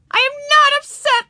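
put-on very high, loud child's protest; shrieky, indignant little-girl yell. A narrator's reading. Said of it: "yelled Ramona".

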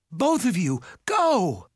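high urgent shout, peaking on the last word. A narrator's reading. Mallory urging mother and brother to flee, shouting. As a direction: shout them away. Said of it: "Mallory shouted".

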